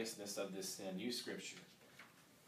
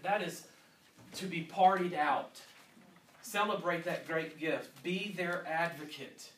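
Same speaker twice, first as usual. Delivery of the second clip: fervent preaching; 5 semitones higher, 6 dB louder than usual. Acted. Preaching at full force.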